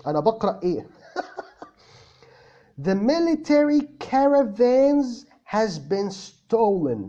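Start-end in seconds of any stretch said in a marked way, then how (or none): 2.9-7.0 slow, laughing American read; American accent. Shown as a demonstration. Teacher reads Amr's sentence with American accent, slowly. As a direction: drawl "military caravans" in American English. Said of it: "بالأكسنت ... الأمريكان".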